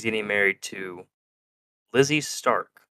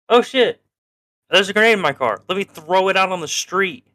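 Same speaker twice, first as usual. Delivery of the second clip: mock alarm; 6 semitones higher, 3 dB louder than usual. Acts out finding a grenade.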